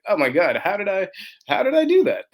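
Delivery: rising to a high, loud "how did I do that"; disbelieving your past self, voice climbing high; amazed disbelief. Retold or imitated. Founder marveling at his early self, amazed. Looking back at early company.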